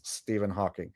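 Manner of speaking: soft, barely audible slurred mumble; mumbling Stephen Hawking. Pretend. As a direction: mumble the name under your breath. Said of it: "mumbling Stephen Hawking".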